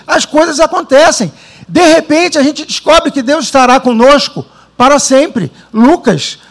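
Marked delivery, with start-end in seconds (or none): none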